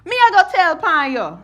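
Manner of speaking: high, loud Patois retort; cousin's taunt in Jamaican Patois; Jamaican Patois snap-back. Retold or imitated. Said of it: "snapping back at one of my cousins".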